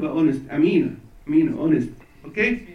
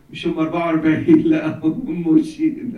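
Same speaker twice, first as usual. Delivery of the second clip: laughing joke; 7 dB louder than usual. Real, not acted. Joking correction with laugh.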